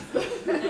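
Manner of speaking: quick bright laugh; a brief high burst of laughter; short delighted laugh. Real, not acted. Laughs after the performance.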